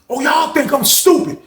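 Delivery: high-pitched indignant rant; angry accusation. Retold or imitated. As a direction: play an outraged man confronting everyone. Acts old head's angry accusation.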